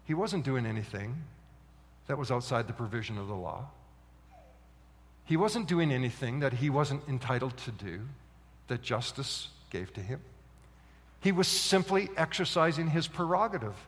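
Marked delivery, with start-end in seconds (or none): 0.0-10.2 quieter. Acted. Preacher turns softly reflective.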